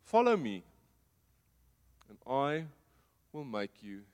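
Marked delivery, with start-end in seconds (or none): 0.0-0.6 Jesus calls loudly to the fishermen on the shore; calling out. Acted. Jesus shouts to fishermen on the shore, loud call. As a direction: call it out across the water, high and loud. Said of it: "And he shouts at them".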